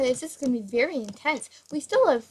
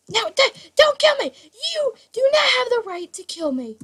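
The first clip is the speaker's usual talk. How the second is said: high, loud mock-panicked plea; hammed-up protest; 10 semitones higher, 5 dB louder than usual. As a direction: plead dramatically for your game life. Begs opponent not to shoot.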